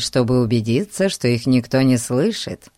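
plain reading voice

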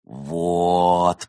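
one long stretched vowel; drawn-out word. Acted. Tipsy actress savouring her admirer's agreement, drawn-out. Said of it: "протянула Изольда".

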